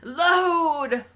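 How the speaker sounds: high, loud drawn-out mock call; mock echoed cry. Cohost echoes the boyfriend's cry.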